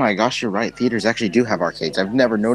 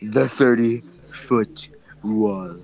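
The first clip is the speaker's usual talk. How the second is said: slow mocking repeat; much slower than usual. Real, not acted. Teasing slow repeat of wall.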